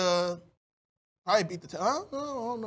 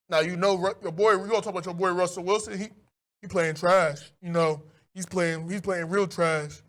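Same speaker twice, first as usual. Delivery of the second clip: Kevon impression; 6 semitones lower than usual. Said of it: "let me do my Kevon impression".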